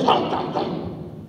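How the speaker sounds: quick voiceless gobbling sounds; breathy, repeated gulps; snake gulping. Acted. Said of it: "and the snake goes".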